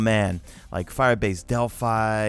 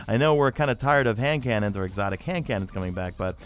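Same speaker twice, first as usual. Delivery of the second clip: ordinary delivery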